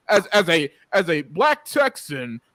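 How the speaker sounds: mock a preachy statement to a friend; mock pompous announcement. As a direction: parody someone solemnly announcing their identity. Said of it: "Have I ever talked to you and be like".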